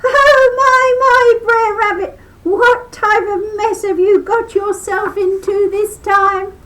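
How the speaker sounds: very high, loud gloating fox; shrill mocking fox howl. A narrator's reading. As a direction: howl with glee at the trapped rabbit. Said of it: "leapt out ... and howled".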